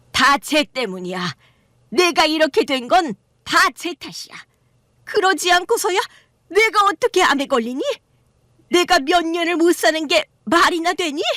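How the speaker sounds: mother-in-law blaming her son's wife for her cancer; accusing mother-in-law. Acted.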